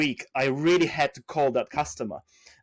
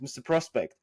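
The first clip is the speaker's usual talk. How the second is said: ordinary talk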